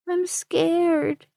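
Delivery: put-on a little girl admitting fear; sleepy girl telling her rescuer she's scared; scared child. A narrator's reading. Frightened child speaks.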